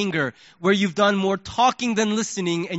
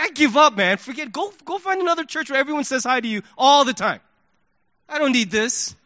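exasperated giving up; 5 semitones higher, 3 dB louder than usual. Retold or imitated. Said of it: "the human side of me".

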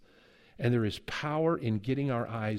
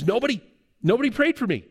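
excited; 10 semitones higher, 5 dB louder, faster than usual. Retold or imitated. Healed man announces it excitedly.

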